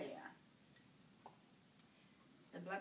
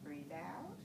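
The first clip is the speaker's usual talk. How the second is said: low, soft, unhurried breathing cues; steady bedside voice for deep breaths; calm breathing coaching; 3 semitones lower, 6 dB quieter than usual. Acted. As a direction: guide each breath in and out gently. Nurse coaches patient's deep breathing.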